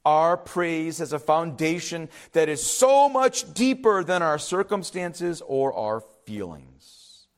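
ordinary talk, nothing marked

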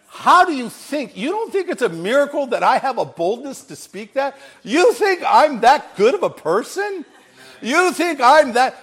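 high, loud, incredulous questioning; fervent preacher's rhetorical questions, pitched high; impassioned challenge. Real, not acted. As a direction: challenge the crowd in disbelief.

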